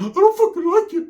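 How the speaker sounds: jumps way up on the complaint; a squeaky outraged yelp; high mock outburst. Quoted friend griping high and loud.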